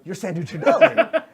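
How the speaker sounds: high, loud awed fan voice; starstruck. Acted. Goblin guard recognizing his idol, starstruck.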